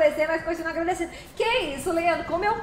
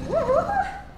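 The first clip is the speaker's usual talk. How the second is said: excited whoop; 10 semitones higher than usual. Real, not acted.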